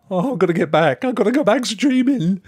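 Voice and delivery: high, loud, urgent wail; mock desperation. Streamer imitating colleagues who miss streaming, mock desperate. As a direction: act out someone itching to return. Acts out itching to stream again.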